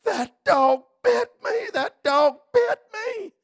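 put-on very high, loud child's wail; screaming child. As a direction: shriek like a bitten little girl. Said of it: "fell out in the yard screaming".

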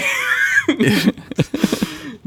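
high shouted burst of laughter; real cackling laugh. Real, not acted. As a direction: let a big laugh explode. Laughing at own email.

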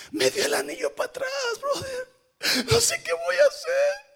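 hammed-up weepy lament; mock crying. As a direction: wail it like a heartbroken drunk buddy. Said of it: "y está llorando".

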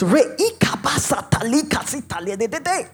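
rapid run of prayer syllables; speaking in tongues. Retold or imitated. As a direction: re-enact praying in tongues, syllables tumbling out. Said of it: "I just started speaking in tongues".

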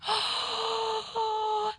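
sharp high gasp. Acted. Comic gasping in mock horror at the crowd.

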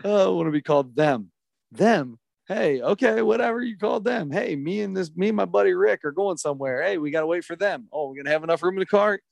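high-pitched eager dad voice; chirpy, overly helpful dad; eager dad impression. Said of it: "I was laughing".

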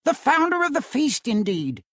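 scornful wife mocking his toast; wife throws her husband's toast back at him, indignant; indignant cry. A narrator's reading. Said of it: "cried Missus Cratchit, reddening".

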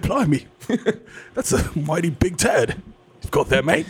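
put-on slow, laughing British accent; mock British accent. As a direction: lay on a cartoon British accent. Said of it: "He's like".

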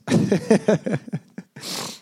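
high, rapid run of chuckles; real giggle at his own joke; laughing. Real, not acted. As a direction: chuckle in a quick high string. Laughs at own silence remark.

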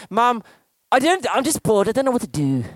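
a parent mimicking a bored kid, not quite a child's voice; mock bored kid. Pastor imitating a bored child complaining to mum, mildly whiny. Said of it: "they never talk like that because they, their voice isn't broken".

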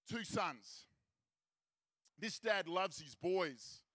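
ordinary talk, nothing marked

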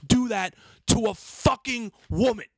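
high, building yell, hitting each curse; retold furious screaming. Berating the man who humiliated a woman, retold furious screaming. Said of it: "That's why I kept screaming".

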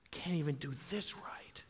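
a defeated whisper to himself; imitating a tired fisherman's whisper; whispered lament. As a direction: breathe it out, beaten. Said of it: "And maybe he's saying".

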